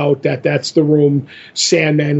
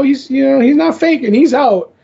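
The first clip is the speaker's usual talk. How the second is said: much higher put-on voice for Morpheus; 8 semitones higher than usual. Morpheus refusing to kill the god, in a much higher put-on voice. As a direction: lift the voice high for his calm refusal. Answering Matthew about the god.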